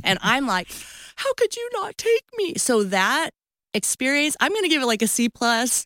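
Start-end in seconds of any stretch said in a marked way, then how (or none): 1.2-2.6 very high, wailing protest; mock tearful reproach. Wife reproaching husband, imitated tearful protest. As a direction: squeal the blame, playing it up. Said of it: "I start crying loudly".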